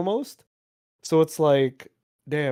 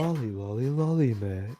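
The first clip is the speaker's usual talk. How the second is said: hoarse sultry voice; 7 semitones lower, 6 dB quieter than usual. Pretend. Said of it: "make my voice more hoarse".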